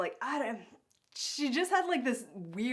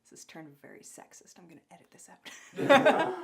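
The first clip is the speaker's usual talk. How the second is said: laughing whispered aside; 4 semitones lower, 4 dB louder than usual. Real, not acted. Host embarrassed about sexist joke, laughing whisper. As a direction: murmur it through a giggle.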